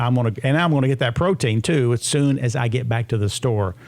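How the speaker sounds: ordinary talk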